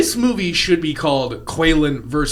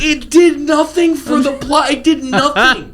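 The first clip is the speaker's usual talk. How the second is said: mock outraged shout; 10 semitones higher than usual. Host acting out his own reaction to the scene, in a high mock shout. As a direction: yell it like an indignant fan. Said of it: "And I'm like".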